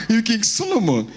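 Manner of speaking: usual speaking voice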